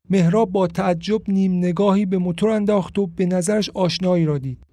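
ordinary delivery

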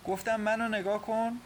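high-pitched, insistent command; firm, decisive. A narrator's reading. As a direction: demand her attention, no refusal allowed. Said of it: "با صدای قاطع گفت". (با صدای قاطع)

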